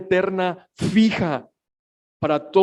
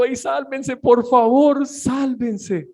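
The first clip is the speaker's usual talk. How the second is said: higher, urgent cry of salvation; pleading shout; 6 semitones higher, slower than usual. Pretend. God crying out to humanity, urgent pleading shout. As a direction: call out like God begging people to flee. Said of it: "como si Dios nos estuviera gritando".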